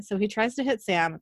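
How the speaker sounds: ordinary delivery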